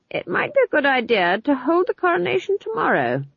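Granny laying down how it will be; commanding old witch. A narrator's reading. Granny pronounces on the coronation.

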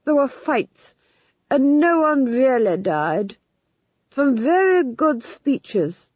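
slow, dreamy duke; dreamily. A narrator's reading. Duke recalling a play to the Duchess, slow and dreamy. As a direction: drift off remembering the play.

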